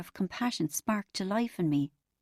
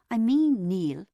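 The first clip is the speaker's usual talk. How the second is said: "I mean, Neil" pressed firmly and louder; with emphasis; 2 semitones higher, 7 dB louder, slower than usual. A narrator's reading. Therapist pressing Neil with firm emphasis. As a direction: lean hard on "I mean". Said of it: "Josephine said with emphasis".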